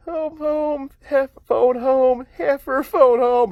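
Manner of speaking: high, drawn-out mock voice; put-on high character voice; playful movie-quote imitation. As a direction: stretch it out high like a silly alien impression. Imitates Heifer playing around on break.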